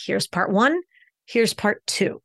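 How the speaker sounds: "part one" left hanging, pause, "part two" landed; break between parts. Shown as a demonstration. Coach showing how to separate two names, with a pause between. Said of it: "put a little break or a, a little extension between".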